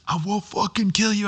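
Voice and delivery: high, tense mock threat from a robber; hammed-up bad cop snarling at the victim. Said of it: "The other guy gets it".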